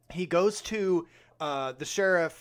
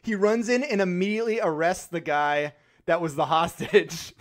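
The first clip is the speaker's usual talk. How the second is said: ordinary talk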